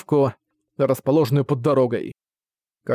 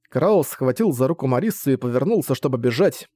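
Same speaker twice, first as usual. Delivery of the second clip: usual speaking voice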